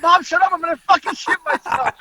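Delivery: very high, desperate mock yell; retold frantic yelling. Yelling back at screeching mother.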